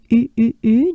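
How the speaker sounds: three separate rounded French U sounds, like "ee" through pursed lips; droopy-mouthed French U. Shown as a demonstration. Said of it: "droopy face, droopy mouth".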